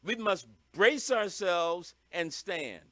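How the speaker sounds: ordinary talk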